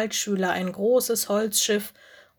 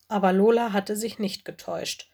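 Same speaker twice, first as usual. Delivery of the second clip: ordinary delivery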